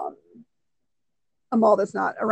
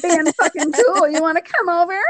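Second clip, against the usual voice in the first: playfully cool; 5 semitones higher than usual. Retold or imitated. Acting out their reply to coworkers.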